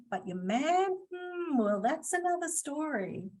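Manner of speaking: hint coyly at a naughty secret; playful insinuation. Pretend. Trainer joking about stealing your man, playful insinuation.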